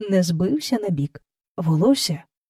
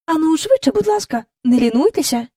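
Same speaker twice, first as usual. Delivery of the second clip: higher, firm and brisk hurry-up; stern nanny; 8 semitones higher than usual. A narrator's reading. Mary Poppins sternly hurrying the children along. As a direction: urge them on sternly. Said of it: "суворо глянувши на дітей".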